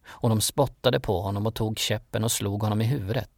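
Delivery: ordinary delivery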